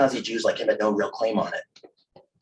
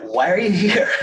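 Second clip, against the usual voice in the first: high, loud, incredulous jab; hammed-up schoolboy scorn; mocking question; 9 semitones higher, 5 dB louder than usual. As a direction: challenge his right to be here, pitched way up. Mocking boys in a story.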